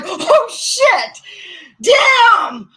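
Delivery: high loud shocked yelp; shocked outburst. Retold or imitated. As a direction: yelp in mock alarm. Reacting to purse beating.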